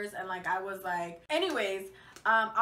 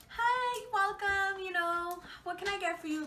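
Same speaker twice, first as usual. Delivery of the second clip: high, bright-eyed fake nice voice; sweet customer voice; 8 semitones higher than usual. Shown as a demonstration. Cashier putting on her sweet voice for a customer. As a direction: chirp it like a cheerful cashier greeting. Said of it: "you get your sweet voice".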